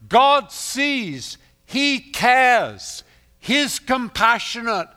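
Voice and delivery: loud, high, ringing sermon voice; rising pulpit proclamation; full-force preaching. Acted. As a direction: preach it out to the whole hall.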